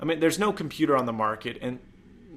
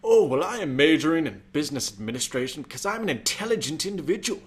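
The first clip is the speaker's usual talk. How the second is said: pompous; 5 semitones higher, 4 dB louder than usual. Retold or imitated. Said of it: "So I'm just like".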